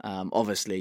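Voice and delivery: normal talking voice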